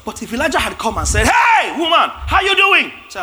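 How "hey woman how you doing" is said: high, loud cheery call; hearty prophet greeting. Acted. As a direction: hail her brightly like a jovial prophet. Preacher acts Elijah greeting widow.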